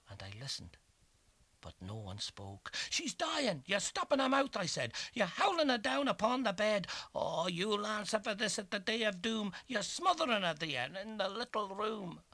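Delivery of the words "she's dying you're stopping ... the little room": high, loud, frantic blame; anguished accusation. A narrator's reading. Anguished accusation through door.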